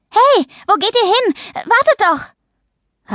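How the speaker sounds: urgent high-pitched shout after someone; calling after them. A narrator's reading. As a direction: call out to stop them, stretching the last words. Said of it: "als Paula hinter ihnen herrief".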